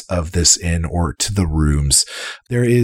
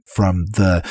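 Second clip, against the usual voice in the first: ordinary talk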